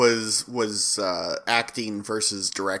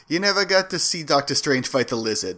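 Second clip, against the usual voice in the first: usual speaking voice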